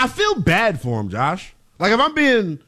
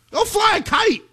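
much higher, incredulous dismissal; exasperated outburst; 14 semitones higher than usual. Real, not acted. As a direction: let it fly as a scoff. Mocking the team's PR rules.

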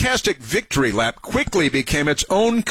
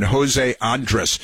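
ordinary talk

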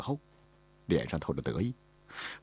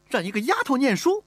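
much higher, outraged question; indignant disbelief; 3 dB louder than usual. Acted. Mistress aghast the master lets a maid study, indignant. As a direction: glare and refuse to believe it. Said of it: "乌雅氏瞪起了眼睛".

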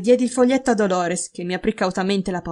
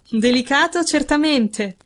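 amused; 5 semitones higher, slower than usual. A narrator's reading. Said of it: "con un sorriso divertito".

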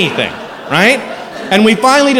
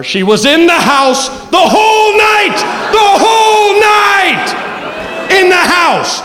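disbelieving shout; 12 semitones higher, slower than usual. Real, not acted. Comic telling audience the girl stayed all night, disbelieving shout.

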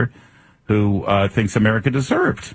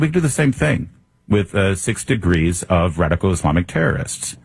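normal talking voice

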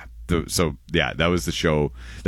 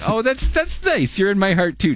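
mock touched; 14 semitones higher than usual. Teasing reply to cohost.

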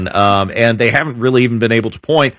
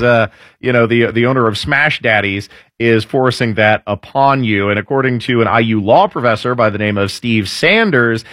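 normal talking voice

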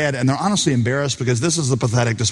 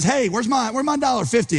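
demanding; 8 semitones higher than usual. Retold or imitated. Forgiven man demands petty debt.

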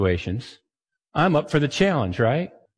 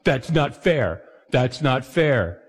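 put-on slow, drawn-out kid complaining; a slow mock kid protest; childish whine; much slower than usual. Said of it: "As a kid, I was thinking about being fair".

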